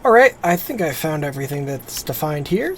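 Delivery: usual speaking voice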